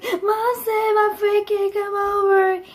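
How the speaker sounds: high, dragged-out tearful whine; mock crying. Child complaining to dad on the couch, mock crying. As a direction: wail it slowly like a hurt child. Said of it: "I started crying louder".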